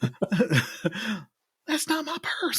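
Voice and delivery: high cartoon boy voice; Bobby Hill. Podcaster quoting the cartoon boy about a purse, high mock voice. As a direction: squeal it like a cartoon kid. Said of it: "like an older Bobby Hill".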